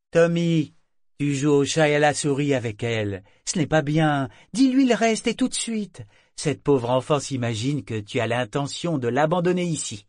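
high, loud scolding voice; scolding figurehead. A narrator's reading. Figurehead scolding the captain, high and loud. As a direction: scold him into confessing.

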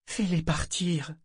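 put-on high, slow, childlike plea; pleading. A narrator's reading. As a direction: ship begging the captain to chase the fears away. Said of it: "une supplique muette dans sa voix".

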